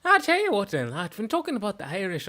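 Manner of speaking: lilting, high-rising Irish accent; Irish lilt with swooping pitch. Shown as a demonstration. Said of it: "main difference between the Irish".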